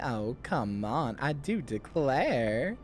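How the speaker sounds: low, drawn-out southern belle accent. Shown as a demonstration. Streamer demonstrating for chat, coy southern accent. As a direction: play coy with a slow southern drawl. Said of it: "my southern voice is like".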